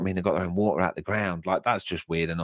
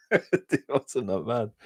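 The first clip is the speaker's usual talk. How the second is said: slow, higher, through a laugh; 4 semitones higher, much slower than usual. Real, not acted. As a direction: say it while chuckling. Laughs at own broken fingers.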